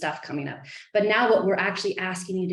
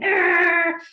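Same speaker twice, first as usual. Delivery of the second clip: loud mock growl of frustration; frustrated growl; 9 semitones higher, 10 dB louder than usual. Coach venting pretend anger about swimming, loud mock growl. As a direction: growl it out like a fed-up kid.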